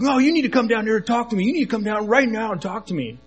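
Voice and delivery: high, insistent demand; imitated yelling. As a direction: imitate an angry stranger calling up from below. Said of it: "He just kept yelling".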